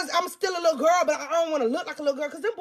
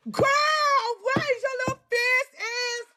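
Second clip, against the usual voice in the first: mock scolding; 8 semitones higher than usual. Mocking her younger self.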